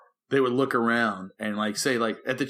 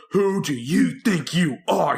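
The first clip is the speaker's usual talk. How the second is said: slow, drawn-out indignant outburst; mock angry; 4 semitones higher, 4 dB louder, slower than usual. Youth worker mimicking the pastor snapping at a teasing kid, mock angry. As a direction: imitate an offended pastor losing his temper. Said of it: "like, really angry".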